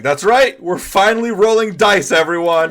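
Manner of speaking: loud, high hype call to the table; excited cheer. Real, not acted. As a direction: pump everyone up. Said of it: "Be excited!".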